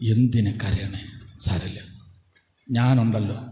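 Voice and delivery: husband consoling his crying wife, gently; low, gentle comfort. Retold or imitated. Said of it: "ഇയാൾ പറയാണ്".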